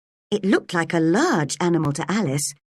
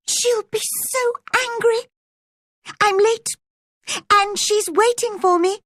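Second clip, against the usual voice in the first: panicked rabbit. Acted. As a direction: flap about in a high panic, late.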